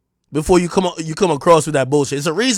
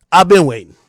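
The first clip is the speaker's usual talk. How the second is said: I've been waiting, louder, stress on been; louder repeat; 6 dB louder than usual. Real, not acted. Host loudly taunting the rapper who threatened him, louder. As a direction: punch the words out the second time.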